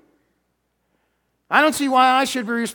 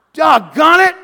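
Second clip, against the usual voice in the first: mock frustrated shout, much louder and higher; preacher hamming up outrage at John's self-naming; exasperated outburst; 7 semitones higher, 13 dB louder than usual. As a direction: blow up in comic exasperation.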